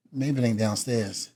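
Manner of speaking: muttered low under the breath; quiet aside. Retold or imitated. Retold thought at the elevator.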